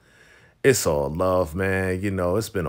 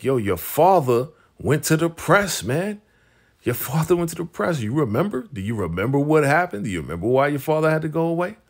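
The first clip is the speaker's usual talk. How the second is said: higher-pitched, pressing questions; insistent reminding; 7 semitones higher than usual. Retold or imitated. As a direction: imitate someone urgently jogging a friend's memory. Retold urgent reminder about his father.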